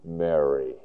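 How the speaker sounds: low, soft, tender call of a name; loving, affectionate tones. Acted. Jesus calling Mary by name in the garden, tenderly. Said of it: "his beautiful, loving, and affectionate tones".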